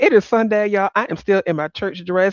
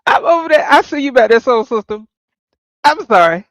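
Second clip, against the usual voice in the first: usual speaking voice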